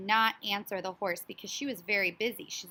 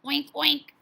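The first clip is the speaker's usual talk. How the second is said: higher, louder oinking; pig oink; 5 semitones higher, 7 dB louder than usual. Acted. Teacher voicing the pig for her class, loud oink. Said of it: "pig that says".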